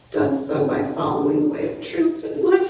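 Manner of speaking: ordinary talk, nothing marked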